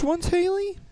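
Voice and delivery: very high squeaky put-on voice; silly high-pitched voice; mock rapper voice. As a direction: squeak the question in the bit voice. Joining the squeaky voice bit.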